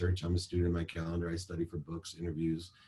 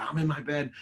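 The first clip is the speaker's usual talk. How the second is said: daughter voice; 8 semitones higher than usual. Retold or imitated. Imagined daughter replies, higher voice.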